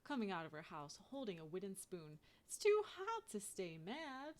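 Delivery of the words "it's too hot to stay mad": Miss Johnson calling out. A narrator's reading. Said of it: "Miss Johnson says".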